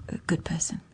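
whispered aside; mock-modest whisper. Joking self-praise said under breath.

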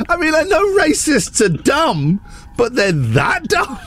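high, half-laughing exasperated rant; incredulous rant. Real, not acted. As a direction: laugh in disbelief at the stupidity. Incredulous rant about Brexit.

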